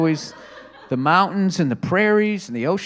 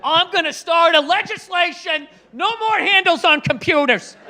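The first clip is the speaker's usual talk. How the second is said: higher pitched indignant rant, laughing; ranting agent; 12 semitones higher than usual. Retold or imitated. Fed agent ranting about computer handles, indignant. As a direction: declare it like an outraged official.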